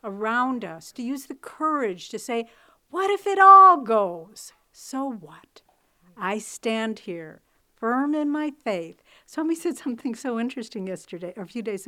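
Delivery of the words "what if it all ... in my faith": bold declaration. Acted. Preacher voicing faithful declaration.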